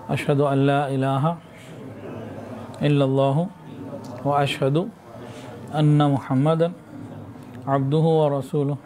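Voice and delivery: slow, measured Arabic recitation in short phrases; leading repeat-after-me prayer. Real, not acted. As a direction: lead them word by word, drawn out, pausing for response. Leads congregation in repentance formulas.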